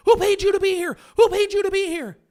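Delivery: loud, high, hostile yell repeated twice; retold shout. Reporters shouting at a press conference witness, loud hostile yell. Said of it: "you know what they shouted to him".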